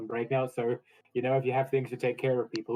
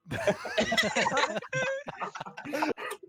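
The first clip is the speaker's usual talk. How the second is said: high burst of laughter. Real, not acted. Laughing at own joke.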